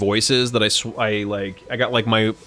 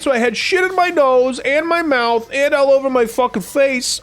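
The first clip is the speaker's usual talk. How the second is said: high mock voice; 14 semitones higher, 5 dB louder than usual. Quoting someone in high voice.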